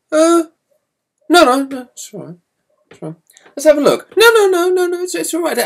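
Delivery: high, hurried denial; panicked protest. Retold or imitated. Boy panicking as dad asks for watch, high and flustered.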